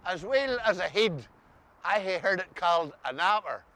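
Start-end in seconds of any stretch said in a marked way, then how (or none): none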